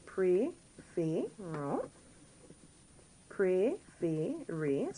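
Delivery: "Préférons", "Préférez" very slowly, syllable by syllable; teacher's drawn-out breakdown of "Préférons" and "Préférez"; acute accents. Shown as a demonstration. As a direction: sound out "Préférons" and "Préférez" with each syllable rising. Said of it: "They're just acute accents".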